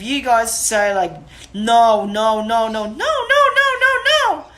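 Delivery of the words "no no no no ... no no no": string of no rising very high and loud, laughing; wrong happy no. Shown as a demonstration. Showing owners the wrong way, squeaky laughing no's. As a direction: sing the no's up high like praise. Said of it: "the dog's gonna understand that no is a good thing".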